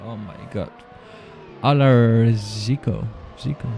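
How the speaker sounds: ordinary delivery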